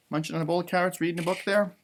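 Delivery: ordinary delivery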